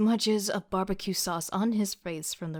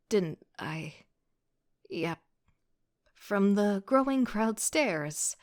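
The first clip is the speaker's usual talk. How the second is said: ordinary delivery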